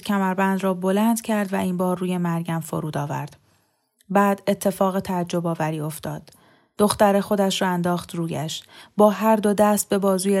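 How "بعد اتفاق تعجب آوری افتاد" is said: ordinary delivery, nothing marked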